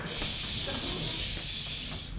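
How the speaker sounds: normal talking voice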